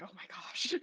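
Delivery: breathy, unvoiced near-whisper; hushed oh my gosh. Listening to friends tease, a whispered oh my gosh. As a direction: mutter it under your breath, embarrassed. Embarrassed reaction to friends' chat.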